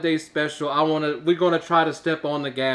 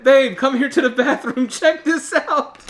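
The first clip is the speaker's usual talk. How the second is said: higher, amused mock summons; excited call to wife; 7 semitones higher, 3 dB louder than usual. Husband calling wife to the bathroom, mock excited. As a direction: call her over, voice rising toward laughter. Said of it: "then I told my wife".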